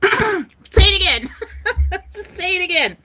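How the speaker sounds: high, loud, laughing excitement; giddy pleading. Retold or imitated. She begs him to repeat the good news, giddy. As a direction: beg delightedly through laughter. Acts begging to hear news again.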